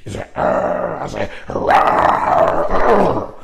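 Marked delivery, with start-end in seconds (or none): 0.3-1.0 much higher growling yell imitating the boy; imitated angry kid roar; mock kid growl. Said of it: "I yelled at him right back". 1.5-3.3 put-on much higher, louder growling roar back at the kid; mock angry adult roar; yelling right back. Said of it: "I yelled at him right back in his face".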